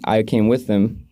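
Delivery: ordinary talk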